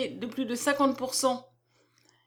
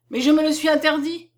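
louder, higher protest; exclaiming; 4 semitones higher, 6 dB louder than usual. A narrator's reading. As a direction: cry out in mild objection. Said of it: "s'écria David".

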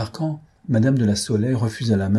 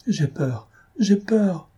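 high plaintive whimper, climbing on the repeat; panicked, plaintive; 10 semitones higher, much slower than usual. A narrator's reading. Frightened marquise clinging to Guy's arm, panicked plaintive voice. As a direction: whine in fright, higher each time. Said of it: "répétant d'une voix affolée et plaintive".

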